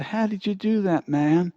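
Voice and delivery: amazed question. Acted. Little Billy amazed on return.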